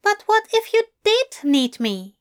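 interrupting. Acted. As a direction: Aziraphale breaking in. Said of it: "Aziraphale interrupted".

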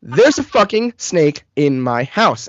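slowly spelled out, word by word; measured, exasperated over-clarity; exasperated slow repeat. Retold or imitated. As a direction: repeat it slowly to someone not getting it. Slow repeat to confused friend.

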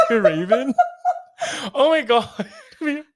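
high helpless laughter, near crying; laughing hard. Real, not acted. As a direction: laugh through the words, can't stop. Laughing fit over grim joke.

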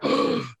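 high, sharp intake of breath; acted gasp of awe; amazed gasp. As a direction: gasp in mock astonishment. Acted awe after crossing coals.

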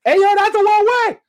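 high, loud yell down the street; bystander calling out. Acted. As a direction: holler a warning from out of nowhere. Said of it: "you hear a brother from I don't know where".

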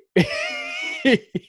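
high squeal of laughter; shrieking laugh. Real, not acted. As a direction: burst into a shrill laugh. Bursts out over baby's size.

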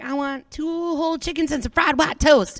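lightly imitated movie diner order; Blues Brothers. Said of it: "think of the Blues Brothers".

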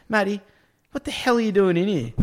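loud, high-pitched retold confrontation; mock exasperated boss. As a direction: imitate a boss demanding an explanation.

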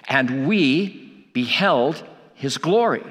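"And we beheld his glory", drawn out with pauses between phrases; slow emphatic restatement. Shown as a demonstration. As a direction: say "And we beheld his glory" very slowly, weighing each part. Theme verse restated for emphasis.

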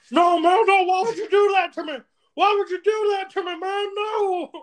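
high, loud mock wailing; mock distraught wail. Friend protesting his prank tattoo, mock wail. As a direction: imitate a friend's outraged high-pitched yell.

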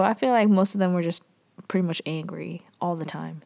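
ordinary delivery

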